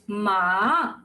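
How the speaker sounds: "ma" dipping low, then rising; third-tone "ma", long low scoop upward; falling-rising third tone. Shown as a demonstration. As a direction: draw "ma" down low and lift it at the end. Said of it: "The third tone is a rise and fall".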